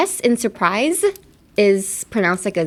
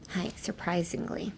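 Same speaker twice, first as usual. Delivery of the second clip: unvoiced whisper of surprisingly; whispered word; 2 semitones lower, 14 dB quieter than usual. Shown as a demonstration. Teacher whispers surprisingly to the class.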